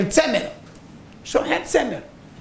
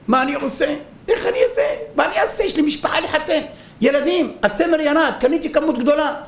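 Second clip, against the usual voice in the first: desperate merchant; 8 semitones higher than usual. Acted. Merchant pleads about his losses.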